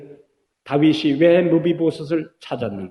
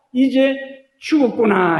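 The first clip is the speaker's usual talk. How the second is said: despairing thought; 10 semitones higher, 5 dB louder than usual. Acted. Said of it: "무비보셋의 마음속에 어떤 생각이".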